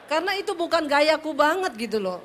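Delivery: ordinary talk, nothing marked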